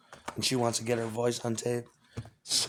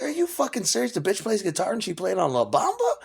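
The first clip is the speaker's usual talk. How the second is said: much higher, louder, floored; stunned disbelief; 9 semitones higher, 4 dB louder than usual. Retold or imitated. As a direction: react like you just can't believe it. Retelling stunned reaction about Carol.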